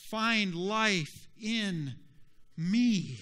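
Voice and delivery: loud, high, slow invitation; impassioned Jesus. Acted. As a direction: stretch each phrase out with full-voiced urgency. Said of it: "like Jesus said".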